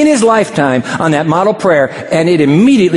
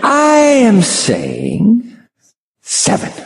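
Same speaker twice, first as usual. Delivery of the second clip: drawn-out proposal; 8 semitones higher, much slower than usual. Pretend. Peter offers seven forgivenesses.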